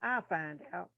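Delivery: low, soft aside to oneself; retold giving up. Student gives up on hearing Nurse Griggs, low resigned aside. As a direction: let it go softly, pitch dropped. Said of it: "And I be like".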